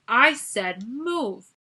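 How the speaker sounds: loud, drawn-out slow yell. A narrator's reading. Guard yelling at the scouts, loud and slow. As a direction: stretch each word, yelling. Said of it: "leaned toward them and yelled".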